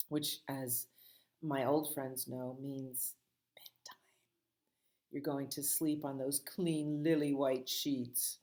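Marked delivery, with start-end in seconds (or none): 2.6-4.0 fading unvoiced whisper. Shown as a demonstration. Teacher whispering bedtime to old friends. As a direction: breathe out bedtime like a secret.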